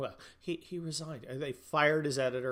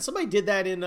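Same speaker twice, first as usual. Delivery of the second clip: ordinary delivery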